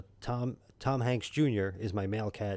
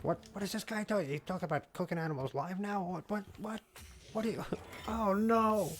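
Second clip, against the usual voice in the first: high, incredulous squeal of disbelief; Pete; 11 semitones higher than usual. Retold or imitated. Viewer scolding the streamer about cooking animals, high shocked squeal. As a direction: squeak out shocked outrage, breaking into a laugh. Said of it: "Pete is gonna come after me".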